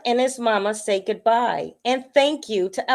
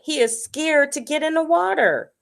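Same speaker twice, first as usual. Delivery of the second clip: ordinary delivery, nothing marked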